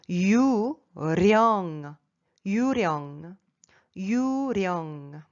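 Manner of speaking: 유령 with a rolled R starting 령. Shown as a demonstration. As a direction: stretch 유령 and roll into the second syllable. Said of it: "ça se prononce".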